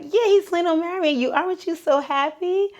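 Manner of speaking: eager excited mom. Retold or imitated. Mom eagerly tells her daughter of the surprise, high and excited. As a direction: gush it out like happy news.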